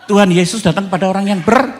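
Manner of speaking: high, loud preacher's call to the crowd; fiery preaching. Acted. Preacher rallying the congregation, high and loud. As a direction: rouse the church.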